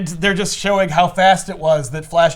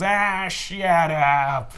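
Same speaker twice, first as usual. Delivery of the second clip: Bugs Bunny voice; much slower than usual. Retold or imitated. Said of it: "Bugs Bunny off screen goes".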